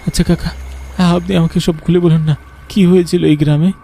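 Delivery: put-on boy coaxing the boatman for the story; curious boy asking. (উঁচু গলায় কৌতূহলী বালকের অনুরোধ)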